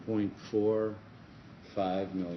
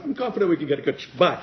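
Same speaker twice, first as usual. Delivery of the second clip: nervous rush; 8 semitones higher, 9 dB louder, much faster than usual. Retold or imitated. Retold nervous reply.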